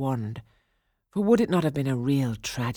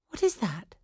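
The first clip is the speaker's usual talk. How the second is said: lightly voiced young princess, higher pitch; a curious, higher-pitched question; 7 semitones higher than usual. A narrator's reading. Aurora questions the old woman about the toy, curious.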